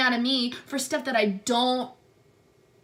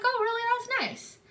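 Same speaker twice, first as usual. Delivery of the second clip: much higher, sugary fake-sweet reply; fake polite; 12 semitones higher than usual. Answering the tech who looked her up, fake sweet. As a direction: chirp it back with fake politeness.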